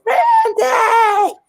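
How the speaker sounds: high-pitched Southern yell of a name; Miss B. Retold or imitated. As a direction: holler it like calling a husband, drawn out and high. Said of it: "You know how Miss B goes".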